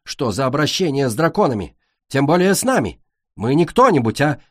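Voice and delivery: high, loud, outraged protest; indignant. Acted. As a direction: rage at the insult. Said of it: "зашипела Цунами".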